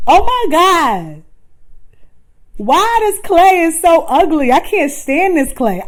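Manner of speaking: overdone wail of frustration over a botched pot; exasperated self-disgust. Acted. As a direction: throw up your hands at the ugly clay. Dramatized harsh self-criticism quote.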